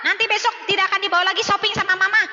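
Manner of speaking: a touch of a hammed-up strict mum; stern scolding mum. Shown as a demonstration. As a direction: scold the naughty child and threaten a hand smack. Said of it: "Kayak gitu, Bun".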